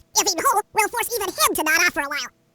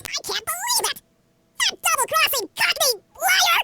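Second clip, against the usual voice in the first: high, drawn-out outraged cry; 9 semitones higher, slower than usual. Acted. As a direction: spit it out at the betrayer, slowly. Betrayed, outraged outburst.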